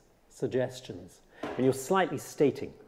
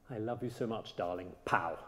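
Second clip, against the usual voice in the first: soft endearment ending in an abrupt pow; quiet, low "darling", then a sudden misplaced "pow"; false accent on the last word; 3 semitones lower, 7 dB quieter than usual. Shown as a demonstration. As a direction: murmur tenderly, then pop out a jarring "pow". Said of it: "it's a little bit like saying".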